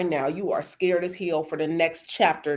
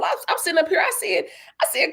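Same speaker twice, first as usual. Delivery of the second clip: ordinary talk, nothing marked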